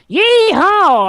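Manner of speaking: high, loud mock Southern cowboy whoop; Southern yee-haw. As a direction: imitate an overdone Southern cowboy cry. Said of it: "but then she'll go like".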